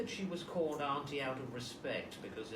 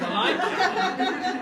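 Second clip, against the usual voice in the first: laughing; 15 dB louder, much slower than usual. Real, not acted. Joking she can see Debbie.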